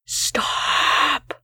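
a hint of a low creature voice whispering; hushed warning from under the bed; whispered warning. A narrator's reading. Creature under the bed warns the narrator, whispered. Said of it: "a low, deep voice whispered".

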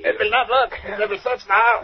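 high, alarmed objection; frightened, pitch pushed up; panicked protest. Acted. Partner despairs of the plan.

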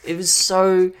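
cringing. Real, not acted. Cringing at old recordings.

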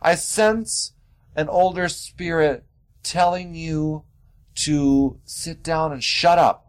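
slow, high, mystical medium voice; hammed-up psychic reading; mock medium. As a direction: drag it out like a fake psychic sensing spirits. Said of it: "walk into their home and be like".

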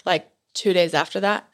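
ordinary delivery

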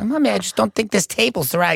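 high fussy mother imitation; complaining mom.

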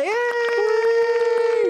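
high, loud celebratory yay; excited cheer. Retold or imitated. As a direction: cheer for the Santa like a crowd. Cheering the Santa announcement.

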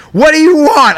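mock angry bark. Agent answering the call with a mock angry bark. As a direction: imitate a fed-up phone agent barking. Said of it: "the most angriest".

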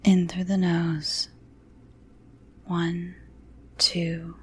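slow soft counting whisper; calm grounding exercise. A narrator's reading. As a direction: count the breaths gently and slowly. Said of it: "her therapist's calm voice".

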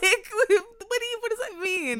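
can't believe what she's hearing; incredulous. Real, not acted. Friend reacts to the haters idea, incredulous and high.

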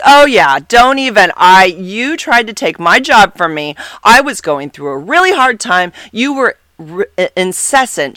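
loud, high indignant tirade; pissed. Retold or imitated. Coworker ranting about the job, loud and pissed. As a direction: scold her hotly. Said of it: "Sounds really pissed".

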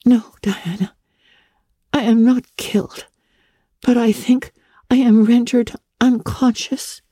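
put-on high, dazed young voice; uncertain Anne. A narrator's reading. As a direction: answer groggily from the ground. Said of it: "answered uncertainly".

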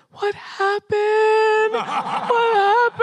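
high, very slow dismayed cry; alarmed Carol. Retold or imitated. Carol seeing the sad nightie, a slow high dismayed cry. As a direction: stretch it out in horrified concern.

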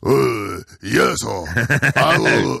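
hammed-up mock Japanese accent. Shown as a demonstration. Host showing how he does it, mock Japanese accent. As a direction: put on a halting Japanese accent with added vowels. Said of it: "This is how I do a Japanese accent".

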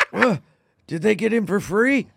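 groaning, indignant sleep-talker; imitated sleep talk. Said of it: "She recorded me one time being like".

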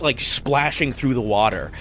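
ordinary talk, nothing marked

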